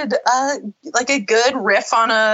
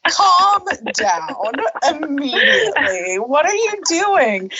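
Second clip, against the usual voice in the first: laughing disbelief; 5 semitones higher than usual. Real, not acted. Laughing at Dawson.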